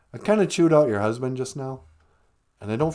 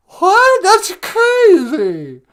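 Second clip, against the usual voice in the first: much higher, louder, gushing caricature of Megan; mock Megan voice; 10 dB louder, much slower than usual. Host plays Megan on the phone, high gushing squeal. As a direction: squeal it like an overexcited fan.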